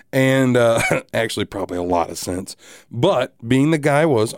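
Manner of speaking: ordinary delivery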